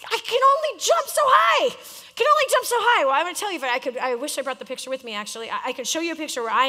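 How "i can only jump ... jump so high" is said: high, loud complaining shout; frustrated protest. Retold or imitated. Player protests to his coach, high and loud. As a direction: whine it back at the coach. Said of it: "It's like".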